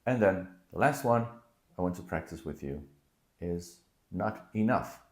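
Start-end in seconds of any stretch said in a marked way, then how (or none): none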